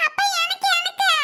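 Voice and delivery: put-on high-pitched little kid whine; pleading child. Child asking father for ice cream too, pleading. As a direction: pipe up wanting a share too.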